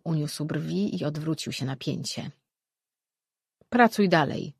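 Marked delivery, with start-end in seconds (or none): 3.7-4.5 loud, short command; a stern master dismissing her; curt order. A narrator's reading. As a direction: order her back to work. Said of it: "polecił".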